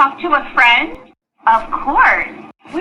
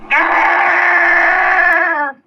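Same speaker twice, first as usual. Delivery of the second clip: long frustrated growl; angry growl; 3 semitones higher than usual. Acted. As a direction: growl in sudden anger. Tracy angry about her iPad.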